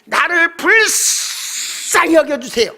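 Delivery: slow, high, drawn-out wailing shout; pleading cry. Retold or imitated. Beggar begging Jesus for mercy, wailing drawn-out shout. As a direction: stretch each word so it carries. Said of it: "소리가 더 나가라고".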